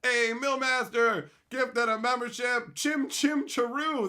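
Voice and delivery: loud, high, drawn-out announcement; celebratory calling out, stretched words; hyped shout-out. Real, not acted. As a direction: hail the donor to the whole audience. Calling out a membership gift.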